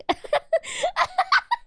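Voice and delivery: squealing high laugh. Real, not acted. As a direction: burst into a shrill giggle. Laugh after the fink slip.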